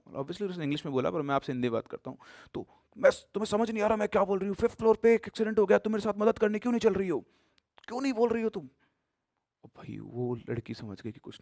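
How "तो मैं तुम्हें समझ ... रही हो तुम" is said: higher, louder, fast angry nagging; building angry demand in the lift; angry shouting. Retold or imitated. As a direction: berate her for not answering. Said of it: "बहुत तेज गुस्से में बोली".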